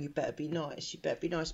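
soft, menacing murmur; creepy Santa. Retold or imitated. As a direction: imitate a sinister Santa speaking very softly. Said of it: "He's stroking the kid's face".

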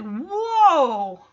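drawn-out, swooping whoa; put-on exclamation of amazement; Joey's imitation. Joey reacting to the better ring, put-on swooping whoa. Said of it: "imitation Joey's doing there".

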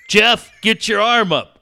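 loud, slow, high call of his name; mock nurse call. Retelling the nurse calling him, loud mock call. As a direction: call him in across the waiting room.

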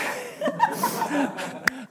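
high laughing fit; helpless high laugh at your own attempt; embarrassed laugh. Real, not acted. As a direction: crack up just as you start performing. Cracks up starting the rap.

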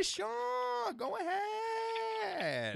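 very high, drawn-out shriek of an impression; exaggerated pizza man. Pizza man waving customers on, absurdly high and drawn out. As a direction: push the mimicry into a stretched falsetto.

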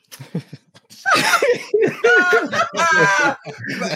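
big high giggling laugh; burst of laughter. Real, not acted. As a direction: laugh out loud. Laughing before doing French.

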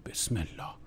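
whispered nervous prayer; muttered prayer. Real, not acted. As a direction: breathe the prayer out in fear. Scared about the missed call.

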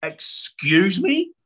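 slow, indignant "excuse me"; retold mother taking offence; affronted mum. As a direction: draw it out, taken aback. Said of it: "My mum's a fiery Polak".

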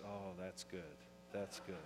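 very soft, low contented murmur; drifting off, hushed and deep; lulled, calmed. Acted. Patient lulled by a soothing doctor, calmed and very quiet. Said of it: "it kinda calms you".

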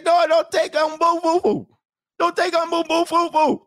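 high, loud hammed-up wail of grief; mock wailing. Mocking a family begging slavers not to take him, loud wail. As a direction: cry out like a family losing a son, half joking. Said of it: "You say".

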